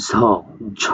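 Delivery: "ঝ" sounded alone as a buzzing zh; demonstrated ZH sound. Shown as a demonstration. Teacher showing how the symbol is pronounced, isolated "ঝ" as zh. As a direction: sound out "ঝ" twice, separately. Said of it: "এটা উচ্চারণ করে অনেকটা".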